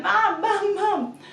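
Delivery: high, loud rapid-fire calls; imitated toddler yell. Toddler at the end of mum's bed, insistent yell. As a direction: mimic a toddler calling over and over.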